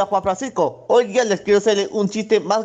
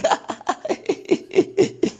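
high, breathy run of laughter; laughing; 12 semitones higher than usual. Shown as a demonstration. Caller ending his joke for the host, high laugh. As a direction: laugh at the end of your own joke. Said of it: "Su risa final me hizo reír".

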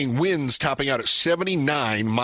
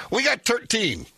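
imitating dad; 9 semitones higher than usual. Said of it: "He goes".

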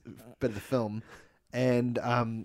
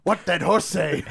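ape talk; 3 semitones higher, slower than usual. Acted. Ape asks about horse.

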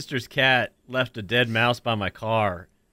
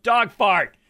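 high, loud imitation of a wife's yell; retold yell; 9 semitones higher, 5 dB louder than usual. Wife yelling out while watching TV, mock shout. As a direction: call it out like an alarm. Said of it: "my wife will yell out".

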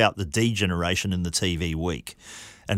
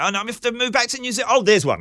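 mock panic, high and fast; hammed-up dread of moving home; panicked self; 13 semitones higher, much faster than usual. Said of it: "and I'm going".

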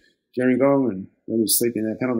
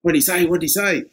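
eager; 6 semitones higher, 4 dB louder than usual. Retold or imitated. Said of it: "The guys go".

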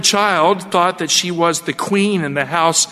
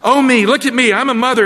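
loud, high, mocking Hagar; a loud sneering slave woman taunting her mistress; contemptuous gloating; 7 semitones higher, 4 dB louder than usual. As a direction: crow over your rival, louder and higher. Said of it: "I imagine she said something like this".

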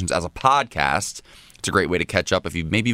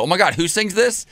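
excited question; 10 semitones higher than usual. Retold or imitated. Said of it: "and I go".

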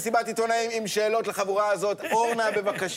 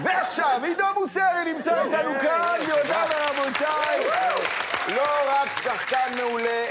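announcer introduction; 7 semitones higher than usual. Real, not acted. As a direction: present the guest like a ringmaster. Host introduces guest with fanfare.

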